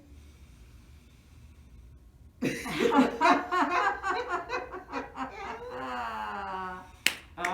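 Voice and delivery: long loud laugh out on one breath; empty the lungs in laughter; laugh on the exhale. Shown as a demonstration. Instructor laughing on the exhale for the class, long loud laugh. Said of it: "laugh on the exhale".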